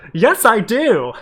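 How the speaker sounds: loud, high, drawn-out eager yes. Acted. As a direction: blurt it out, high and stretched. Eager answer in read fanfiction.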